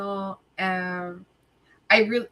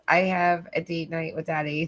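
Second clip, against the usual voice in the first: normal talking voice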